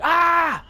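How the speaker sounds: high shouted "Ah!" imitating a smell; yelled high "Ah!", loud and sharp; mock yell. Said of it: "just yelling right now".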